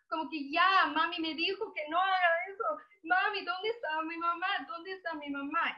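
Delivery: put-on high, whiny child's sobbing; crying. Lost child asking where her mother is, tearful wail. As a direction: imitate a tearful child calling for mamá.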